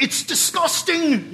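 high, drawn-out mock revulsion; theatrical disgust. Food critic reacting to a dog in the restaurant, appalled. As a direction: stretch it out high, hammed-up appalled.

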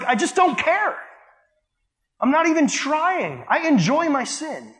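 high, slow, sneering don't-care voice; mock careless sinner. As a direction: mimic a smug sinner who shrugs it off.

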